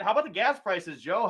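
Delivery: usual speaking voice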